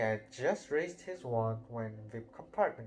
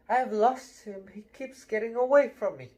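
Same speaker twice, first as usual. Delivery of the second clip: wailing; 8 semitones higher, 7 dB louder than usual. Acted. Neville wailing to Harry and Ron about toad, higher louder wail. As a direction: let it wail out in misery. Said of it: "he wailed".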